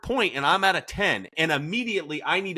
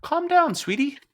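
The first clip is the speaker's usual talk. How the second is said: a high, sugary, dismissive calm down; mock condescending; 10 semitones higher than usual. Shown as a demonstration. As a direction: talk down to a toddler in a sing-song way. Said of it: "Telling her to calm down".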